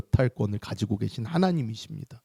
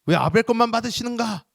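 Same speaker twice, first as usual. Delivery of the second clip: acted indignation, climbing higher as it goes; angry Cain; 4 semitones higher, 4 dB louder, faster than usual. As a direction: complain hotly, building in pitch. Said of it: "화가 나고 오히려 분이".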